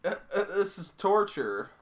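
high pleading, laughing. Real, not acted. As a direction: beg him to stop. Begging him to stop.

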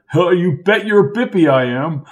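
lightly voiced old Lark, high and jolly; a hint of a jovial old-timer calling him old boy; hearty old college man. Lark's character voice.

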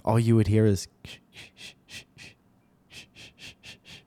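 brush stroke sounds. Shown as a demonstration. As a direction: imitate a brush on canvas with sh sounds. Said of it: "That's the paint".

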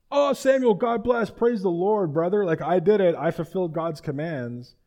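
high, loud, effusive church greeting; hammed-up praise-the-Lord enthusiasm; gushing piety. Saul boasting to Samuel of obedience, high and gushing.

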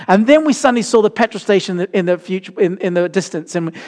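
ordinary delivery

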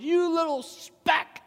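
high roaring taunt at the top of the lungs; giant shouting. Acted. Giant taunting David across the battlefield, roaring. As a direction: bellow down at the little shepherd. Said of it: "The giant shouting at the top of his lungs".

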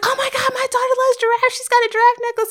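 very high, excited mom impression; gushing mom. Imitating mom bragging about her daughter, gushing squeal. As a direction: squeal it like a proud mother.

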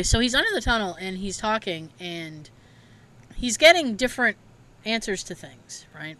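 ordinary talk

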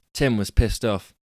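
ordinary delivery, nothing marked

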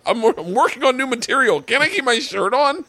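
high-pitched, put-upon protest; exasperated plea. Acted. Comic pleading with the crowd to keep his shirt on, exasperated. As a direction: plead your case, pitched right up.

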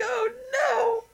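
high crying wail; mock wail. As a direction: wail like a devastated dad. Dad learns he's father.

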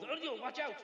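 alarmed shout. Acted. Ferryman warns of danger.

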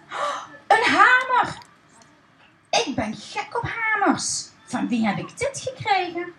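high, thrilled voice of a little wooden man; delighted. Acted. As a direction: gasp with joy at the hammer. Wout delighted by gift hammer. (Wout die blij en opgewonden zijn hamer uitpakt)

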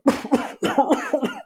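high-pitched mock spluttering; acted choking cough; imitated coughing fit. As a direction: imitate someone coughing up swallowed pool water. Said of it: "so started coughing".

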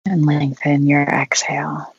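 unhurried meditation guide with long pauses; guided meditation. Acted. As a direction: guide them gently through breathing, leaving long silences.

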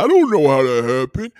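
loud put-on innocent claim; mock-innocent loud shrug of a voice. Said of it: "sitting there".

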